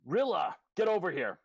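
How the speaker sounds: loud shout across the room; yelling. Acted. Akosha yells for Rilla to come over, shouting. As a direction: bellow the name, up high. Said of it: "and yells".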